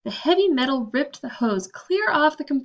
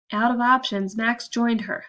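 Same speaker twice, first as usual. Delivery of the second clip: ordinary delivery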